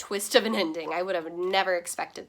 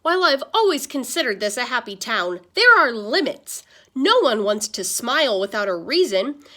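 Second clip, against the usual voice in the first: rally the town like a fired-up pastor; preaching pastor; 8 dB louder than usual. Acted. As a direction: pastor addressing the crowd in loud sermon voice. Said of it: "Pastor Butters summed up the situation".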